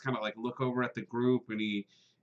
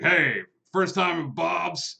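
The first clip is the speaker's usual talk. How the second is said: a big, booming, louder welcome from the burly owner; gregarious big guy; 4 semitones higher, 5 dB louder, slower than usual. Acted. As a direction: greet the newcomer like a jolly innkeeper, louder. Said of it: "he's like".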